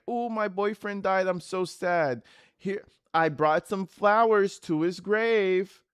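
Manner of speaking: high, drawn-out fake sorrow; hammed-up bereaved girlfriend, stretching the last words; mock grieving. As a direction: play up phony sadness for the camera. Mocks her grieving photo posts.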